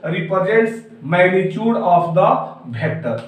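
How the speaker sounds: very slow, word by word, for students to write down; slow dictation. Shown as a demonstration. Teacher dictating the definition to students, very slowly. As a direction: spell it out slowly so the class can copy it.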